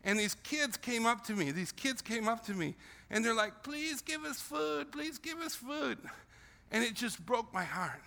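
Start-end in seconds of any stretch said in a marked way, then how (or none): 3.6-6.0 put-on high, soft, slow pleading child voice; begging children. Village kids begging the visitor for food, high soft pleading. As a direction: plead quietly like a hungry child.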